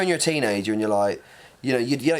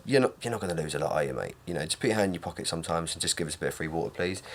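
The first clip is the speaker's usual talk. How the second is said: cheeky plea; 6 semitones lower, 7 dB quieter, faster than usual. Acted. Pleading to Thames Water.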